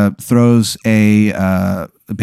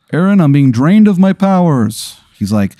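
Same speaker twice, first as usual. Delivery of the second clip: pitched-up, solemn plea of a fading holiday spirit; weary Father's Day spirit; 9 semitones higher than usual. Acted. Game master voicing the Father's Day spirit to Aaron, high and solemn. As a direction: sound like a father figure losing his powers.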